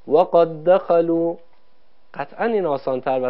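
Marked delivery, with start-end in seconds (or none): 0.1-1.4 slow "و قد دخلوا" merged as wa-qad-dakhalu; one doubled dal. Shown as a demonstration. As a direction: hold one geminated د across "و قد دخلوا". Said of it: "یک دال مشدد تلفظ کنیم".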